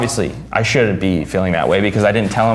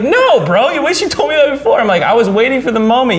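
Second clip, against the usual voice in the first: high excited banter; 10 semitones higher than usual. Retold or imitated. Excited back-and-forth retold.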